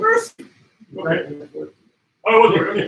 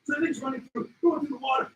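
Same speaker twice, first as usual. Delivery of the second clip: usual speaking voice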